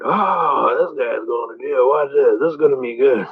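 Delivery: imitated spectators relishing trouble; drawn-out "Oh", then gossip from the gallery; mock murmur of an expectant crowd; courtroom onlookers. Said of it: "all the people in the court".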